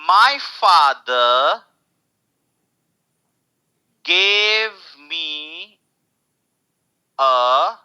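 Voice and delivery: drawn-out classroom demo; slow teaching read. Shown as a demonstration. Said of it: "मेरे पापा ने मुझे दिया क्या".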